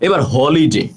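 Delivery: usual speaking voice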